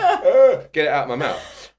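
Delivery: remembering the taste, a loud disgusted ugh then laughing. Retold or imitated. Disgust retold.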